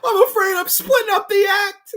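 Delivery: high, loud comic menace; mock menace. Don Rickles about to cut off his arm, high mock menace. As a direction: say it with cartoonish calm menace. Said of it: "I love it".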